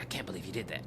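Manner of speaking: low muttered disbelief; stunned husband. Retold or imitated. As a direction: drop low and quiet, as the shocked husband. Husband's stunned reply, voiced low.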